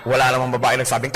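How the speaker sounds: normal talking voice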